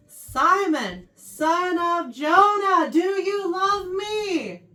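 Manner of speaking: loud, high, drawn-out questioning; insistent. Acted. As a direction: press the question slowly and forcefully. Acting Jesus's insistent third asking.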